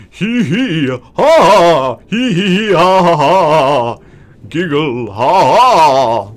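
eerie ghostly giggle. Pretend. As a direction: giggle like a creepy ghost child. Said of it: "Ghost voice".

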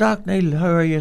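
imitating a coffee shop girl's greeting; acted greeting.

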